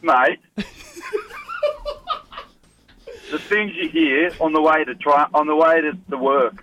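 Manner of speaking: a light put-on Australian accent, pretending to be Steve; a caller faking a mild Australian accent; Aussie accent. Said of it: "How's my Australian accent?".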